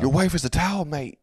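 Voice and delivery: high-pitched mock trash talk; Conor. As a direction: needle a rival in a put-on fighter's voice. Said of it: "Conor pretty much did that".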